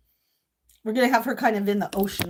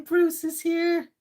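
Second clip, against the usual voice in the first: drawn-out sing-song; 6 semitones higher, much slower than usual. Real, not acted. Drawn-out happy announcement.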